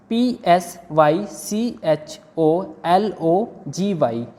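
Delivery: ordinary talk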